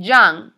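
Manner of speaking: put-on loud, low YOUNG, falling; model pronunciation. Shown as a demonstration. Teacher asks class to repeat, loud model pronunciation. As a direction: push YOUNG out loud for learners to copy. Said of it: "Repeat after me".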